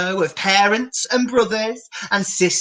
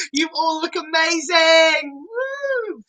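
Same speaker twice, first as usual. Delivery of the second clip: high cheer ending in a whoop; excited cheer; 8 semitones higher than usual. Acted. Presenter cheering the dancing kids, high whoop. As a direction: hype the kids up.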